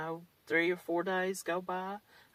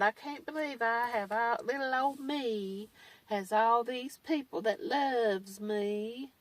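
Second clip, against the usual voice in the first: mock humble-brag; 4 semitones higher than usual. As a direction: mimic her fishing for sympathy. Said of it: "her little post that she put up".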